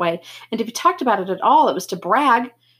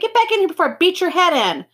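irritated threat; 10 semitones higher than usual. A narrator's reading. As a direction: threaten him, fed up. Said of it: "Dally sounded irritated".

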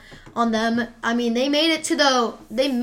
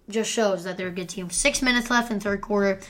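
ordinary talk

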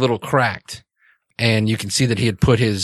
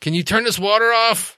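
from another room; 11 semitones higher than usual. Acted. NPC calling from the shower.

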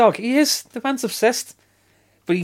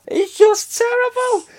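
1 laughing, high; 4 dB louder, slower than usual. Real, not acted. Delighted mock outrage.

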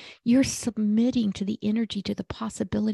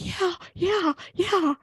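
high, emphatic yeah yeah yeah; eager mock insistence, much higher than own voice; mock eager agreeing; 10 semitones higher, 4 dB louder, slower than usual. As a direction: play the friend who swears it's true, pitched up high. Mock friend insisting on belief.

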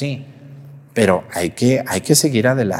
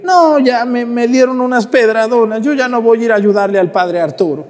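high-pitched mock complaint, easing down as it runs on; griping quitter; 7 semitones higher than usual. Priest mimics a Saint Paul who gives up, high griping. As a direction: whine out excuses in a raised voice. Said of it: "No crean que San Pablo era así de que".

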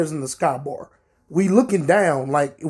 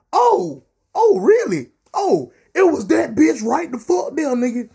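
mock surprise; 8 semitones higher than usual. Narrator acts surprised for security.

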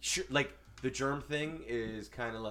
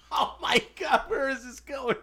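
high, loud crying laughter; laughing shriek; 13 semitones higher, 5 dB louder, slower than usual. Real, not acted. Laughing shriek at pickle bit.

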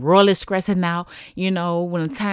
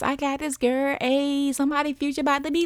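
higher, softer hype-up to herself; a touch of excited self-encouragement; self-pep talk; 6 semitones higher, 4 dB quieter than usual. Retold or imitated. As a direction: pump yourself up in a light inner voice. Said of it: "You, you got this".

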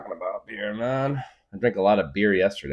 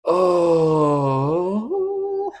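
long wailing oh; 11 semitones higher, 7 dB louder than usual. Real, not acted. Long cry-like exclamation.

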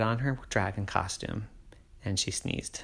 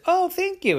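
put-on little girl voice. A narrator's reading. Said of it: "Poppy said".

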